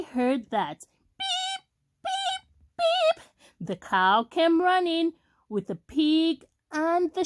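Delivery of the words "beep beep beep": very high, thin horn beeps; distress beeps. A narrator's reading. Stuck truck calls the animals, high distress beeps. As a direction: beep high for help. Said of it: "cried the little blue truck".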